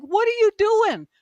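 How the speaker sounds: high-pitched reenacted telling-off; mock yell at a cop, pitched well up; retold yelling. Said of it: "I started yelling at him".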